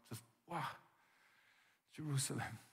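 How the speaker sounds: mournful. Retold or imitated. Jesus weeping over city.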